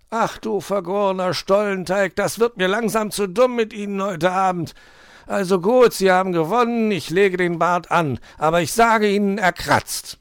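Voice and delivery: high, loud fed-up outburst; grudgingly surrender; exasperated giving in. A narrator's reading. Herr Fuchs gives in to the magpie, exasperated and loud. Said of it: "gab der Fuchs nach".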